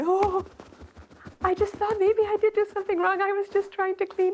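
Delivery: high, anxious apologetic plea; pleading disciple. Retold or imitated. Scolded young disciple to his guru, high nervous apology.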